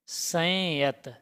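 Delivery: संयत drawn out very slowly; teacher modelling संयत slowly; slow model word. Shown as a demonstration. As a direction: stretch संयत for the class. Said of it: "शब्द क्या होता है?".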